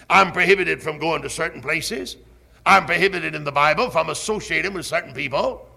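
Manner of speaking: ordinary talk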